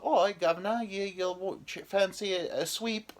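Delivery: high, hammed-up Cockney chimney sweep; English accent. Joker showing girls at a bar his English accent, hammed up. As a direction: put on a high Mary Poppins English accent. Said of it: "I could do a pretty good English accent".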